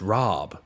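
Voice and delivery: "rob" with a long ah, unlike British rob; rahb pronunciation. Shown as a demonstration. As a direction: open up the vowel in "rob". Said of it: "even if it's pronounced".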